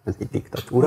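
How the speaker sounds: soft, quick mischievous aside; playful smile. Retold or imitated. Host imitating how people casually talk of dictatorship, playful smile. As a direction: say it lightly through a playful smile, imitating others. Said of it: "pajkosan mosolyogva szoktuk".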